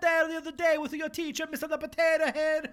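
loud, high cartoon Italian accent. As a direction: play a pizza chef in an Italian accent. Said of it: "in an Italian accent".

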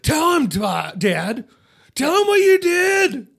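high, loud dramatic demand; hammed-up, anguished confrontation; overacted shouted demand. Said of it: "He's doing the most".

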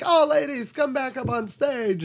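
very high breezy diva. Retold or imitated. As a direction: call out like a diva. Imitating Rose McGowan.

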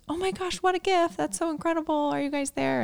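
very high, soft mock squeal; gushing texts. Friends texting about the news, voiced high and gushing.

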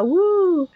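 wolf howl. Pretend. Excited werewolf song howl.

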